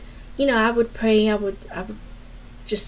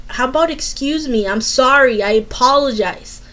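indignant demand; 2 semitones higher, 6 dB louder than usual. Retold or imitated. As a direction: hammer out each apology, pointedly. Retold quarrel, demanding an apology.

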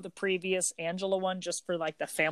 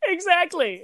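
laughing; 11 semitones higher, 6 dB louder than usual. Real, not acted. Laughing agreement.

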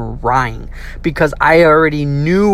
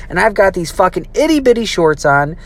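plain reading voice

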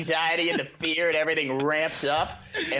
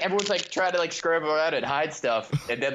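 ordinary delivery

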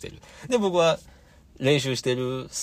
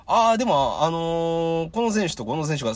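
higher-pitched retold answer; acted reply; 8 semitones higher, 4 dB louder than usual. Podcaster replying to the wrestler mid-intro, acted. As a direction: answer hesitantly in a raised voice.

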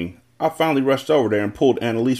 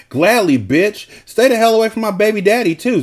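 high, louder taunting retort; yelled comeback; 9 semitones higher, 5 dB louder than usual. A narrator's reading. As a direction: yell it back at a rival. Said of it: "Zakiya yelled".

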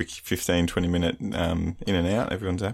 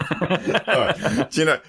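high, laughing all right; 11 semitones higher, 3 dB louder, slower than usual. Real, not acted. Still laughing at his cohost's joke, high and laughing. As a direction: laugh it out, pitch up.

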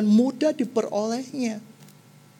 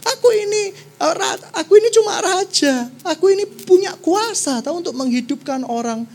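panicked shrieking king; 7 semitones higher than usual. Acted. King of Israel crying out in panic over the letter. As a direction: wail like a terrified king. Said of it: "Dia jerit-jerit".

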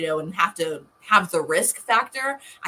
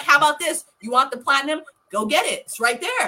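retold exasperation; 9 semitones higher, 4 dB louder than usual. Retells arguing back at fiance.

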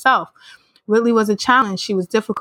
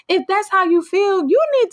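pitched up, fed-up lecturing; high exasperated scold; 11 semitones higher than usual. Real, not acted. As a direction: tell her off with a raised voice.

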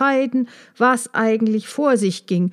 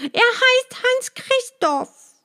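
tearful; 13 semitones higher, slower than usual. A narrator's reading. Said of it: "in ihren Augen kamen Tränen".

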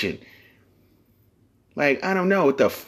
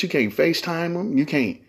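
ordinary talk, nothing marked

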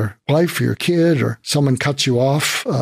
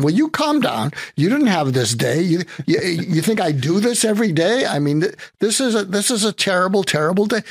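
higher, exasperated insisting; frantic protest; 6 semitones higher than usual. Retold or imitated. Overwhelmed driver telling the trucker to calm down, higher. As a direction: push back, overwhelmed and pleading.

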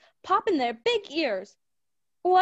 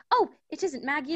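normal talking voice

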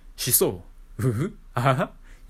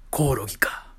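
whispering. Acted. Listing options in a hush.

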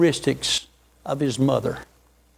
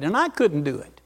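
usual speaking voice

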